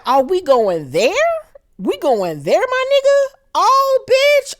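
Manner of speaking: climbing into a high squeal of disbelief; shocked outrage. Real, not acted. Viewer watching him grab a rock, shocked and rising high. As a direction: yelp it up in shock. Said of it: "And I was like".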